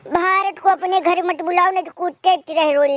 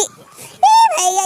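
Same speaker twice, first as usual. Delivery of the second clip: much higher, louder, slow drawn-out call; drawn-out yell; 11 semitones higher, 4 dB louder, much slower than usual. Acted. Calling out to brother, a long high yell. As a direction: stretch the call to carry far.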